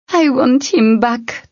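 high, slow plea; pleading for the lost. Acted. Tern as Hessler is left behind, slow plea.

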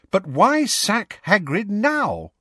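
put-on high, slow, questioning girl; schoolgirl's high-pitched objection; puzzled protest. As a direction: ask in drawn-out disbelief. Angelina asks in upset common room.

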